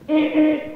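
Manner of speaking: high car-horn honks; imitated car horn. Dad honking in traffic, imitated horn.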